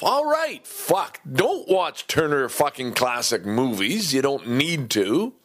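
excited self-pep talk. Retold or imitated. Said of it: "saying to myself".

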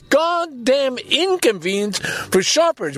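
slow, high-pitched exasperated rant; pissed off. Real, not acted. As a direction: stretch the key words in real indignation. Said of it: "I'm just so pissed at that".

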